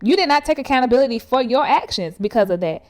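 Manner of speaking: ordinary delivery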